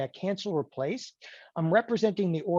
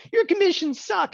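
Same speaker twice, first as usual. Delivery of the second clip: complaining customer; 13 semitones higher, 5 dB louder than usual. Retold or imitated. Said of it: "People like".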